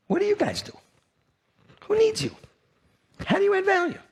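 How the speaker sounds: high, mocking questioner; mock challenger. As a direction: taunt the audience like a skeptic.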